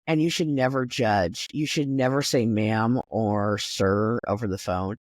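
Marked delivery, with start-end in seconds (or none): none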